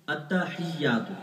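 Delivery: التحیات said slowly with a clear ha and doubled ya; careful التحیات, every letter sounded; correct Arabic pronunciation. Shown as a demonstration. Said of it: "اس کے ہجے یوں ہے". (التحیات آہستہ اور صحیح تلفظ سے)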